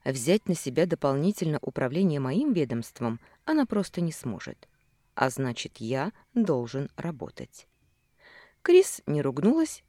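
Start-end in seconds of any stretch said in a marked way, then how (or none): none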